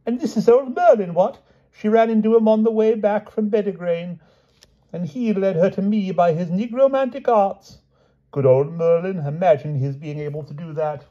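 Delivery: high, admiring gush; delighted dotty king. A narrator's reading. King introduces old Merlin, delighted. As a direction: show off a clever friend.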